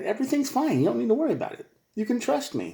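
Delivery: high, lightly mocking reassurance; mock reassuring partner. Partner brushing off worries, high mock reassurance.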